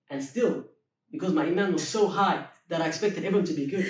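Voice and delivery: normal talking voice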